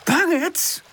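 high, affronted question; indignant echo. A narrator's reading. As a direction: repeat the insult in disbelief. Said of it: "herhaalt Pip".

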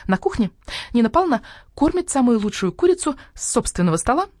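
high, eager hen voice; the hen boasting about her home; proud hen. Acted. Hen tells fairy tale. (гордая курица, голос выше)

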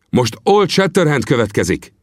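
called out. Acted. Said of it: "kiáltotta Vinetú".

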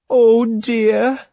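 a put-on wail of alarm; feigned distress. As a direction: cry out like a lady staging heartbreak. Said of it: "she cried".

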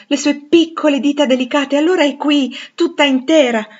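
high, loud, overjoyed recognition; amazed exclamation. A narrator's reading. Rochester feeling that Jane is really here, amazed exclamation. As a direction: cry out with mounting wonder. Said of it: "esclamò".

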